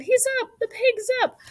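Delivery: very high, excited cheering; crowd yell. A narrator's reading. Fair crowd as Wilbur stands, high cheering yell. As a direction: call the news out in a high cheer. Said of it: "yelled the crowd".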